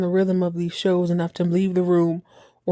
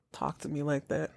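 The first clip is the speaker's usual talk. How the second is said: much softer, lower mutter; barely audible, lower aside; quiet aside; 5 semitones lower, 12 dB quieter than usual. Real, not acted. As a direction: mutter it under your breath. Muttering wish.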